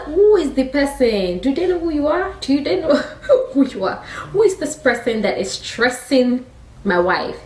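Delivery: high-pitched, hammed-up indignation; outraged king. King hearing his wife is threatened, acted outrage. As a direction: demand it in mock outrage, half laughing.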